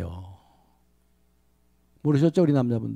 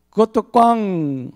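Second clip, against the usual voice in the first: drawn-out, higher "꽝!" with a put-on voice; mock rejection; 6 semitones higher, much slower than usual. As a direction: stretch the verdict out, teasing. Actress rejects third answer.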